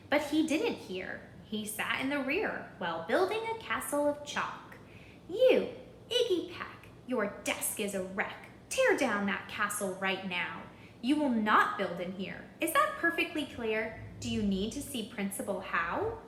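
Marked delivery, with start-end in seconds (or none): 5.3-16.2 scolding teacher. Acted. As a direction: snap at the boy at the back. Teacher scolding Iggy in class.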